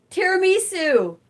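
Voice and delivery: high, loud delighted cry; excited shout. Real, not acted. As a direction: call it out in delight. Calls out favorite dessert.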